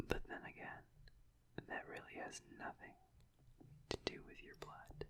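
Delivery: barely audible intimate whisper; confiding whisper. Acted. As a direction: drop to a hushed, secret confession. Confides attraction to the listener.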